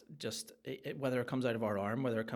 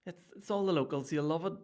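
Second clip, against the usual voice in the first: higher, louder mock local begging for a turn; playful impression of a pestering fan, pitched up; imitating a pushy local; 6 semitones higher, 5 dB louder than usual. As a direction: put on the insistent local asking for a wee turn. Locals pestering him to perform.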